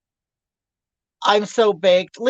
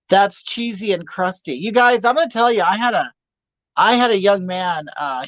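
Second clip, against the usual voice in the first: normal talking voice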